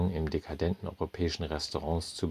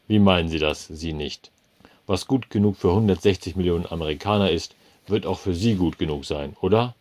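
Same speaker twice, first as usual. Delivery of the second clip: louder, bullying manager; irritated manager; 8 dB louder than usual. A narrator's reading. Manager bullies the customer, loud and irritated. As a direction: lean on him hard.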